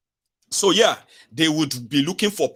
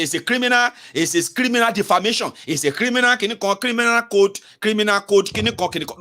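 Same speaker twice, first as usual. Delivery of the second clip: high, loud, repetitive officialese; mock a blustering policeman piling on charges; imitated police bluster, pitched up; police officer; 8 semitones higher, 4 dB louder than usual. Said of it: "Nigeria police always will come in and say".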